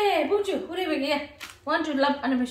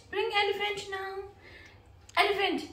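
normal talking voice

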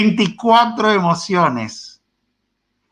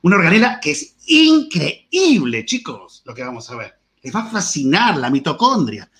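enthusiastic teacher. Shown as a demonstration. Teacher demoing prosody, enthusiastic pitch to students. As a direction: sell the mitochondria like a thrilling story. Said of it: "Eso es prosodia".

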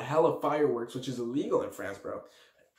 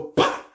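loud plosive bang; imitated explosion; 6 dB louder than usual. Storyteller mimics the firework exploding, imitated bang. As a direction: burst it out like a blast.

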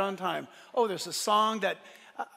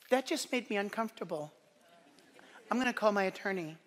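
soft, hushed mock grievance; mock offended complainer; 7 dB quieter, faster than usual. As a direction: imitate a touchy, easily hurt listener quietly. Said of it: "people don't talk like that".

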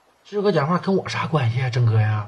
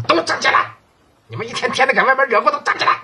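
higher-pitched barked command to underlings; angry order; 10 semitones higher than usual. Acted. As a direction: snap at the boys to stand up. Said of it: "郑哥生气了，骂人了啊".

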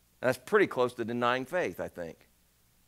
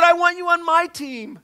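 1 voice of God calling; 13 semitones higher, 10 dB louder than usual. Pretend.

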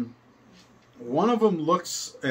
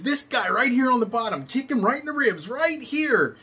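put-on high, insistent teen pointing it out; exasperated teen boy; 9 semitones higher than usual. Teen ringleader showing the kid exactly where to kick, high and pleading. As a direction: spell it out in a much higher frantic teen voice.

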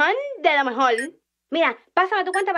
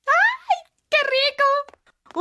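delighted squeal; 9 semitones higher, much slower than usual. Acted. Finally allowed to use phone.

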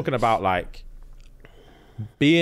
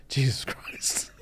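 hushed, drawn-out British exclamation; slow British disbelief, nearly whispered; Gordon Ramsay; 7 dB quieter, much slower than usual. Retold or imitated. Imitating Gordon's shock at the chef, slow British hush. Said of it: "Gordon with his British thing".

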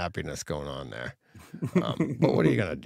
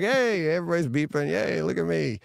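mock cheery; 7 semitones higher than usual. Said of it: "And I'm like".

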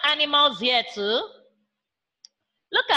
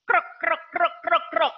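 frog croak; 4 dB quieter, faster than usual. Shown as a demonstration. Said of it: "What animal croaks".

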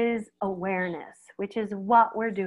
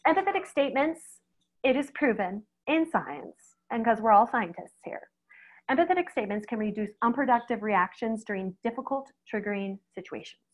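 ordinary talk

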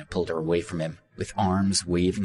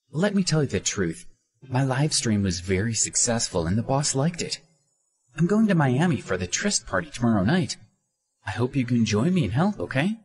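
put-on high, eager young woman; bright high-pitched coaxing; Minnie persuading; 6 semitones higher than usual. A narrator's reading. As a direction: sell him on the trip.

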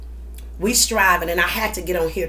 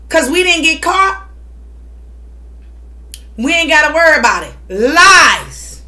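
fired-up rant; 5 semitones higher, 5 dB louder than usual. Real, not acted. Heated confession rant.

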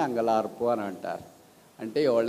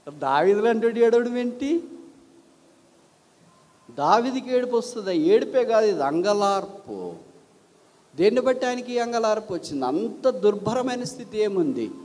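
higher, louder rhetorical sermon questions; preaching at full force; 7 semitones higher, 3 dB louder than usual. Acted. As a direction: hammer home the question to the congregation. Rhetorical preaching about David. (బలమైన ప్రసంగ ప్రశ్నలు)

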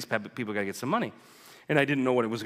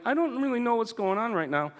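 much higher, overwhelmed mock reply; bewildered; 11 semitones higher than usual. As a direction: pitch it way up, flustered and lost. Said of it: "I'm like".

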